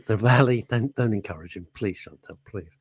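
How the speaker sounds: ordinary talk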